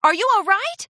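alarmed concern; worried. A narrator's reading. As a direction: check on her urgently. Charity runs over, worried.

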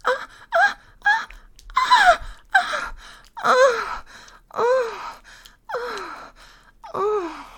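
loud, high moans, gradually subsiding; high-pitched acted moaning; climactic moans. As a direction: cry out repeatedly, then ease down. Acted climax of intimate roleplay.

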